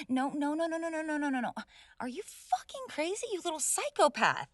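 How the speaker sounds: high frantic repeated no; panicked protest. Acted. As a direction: panic as he attacks. Panicked as lamp is thrown.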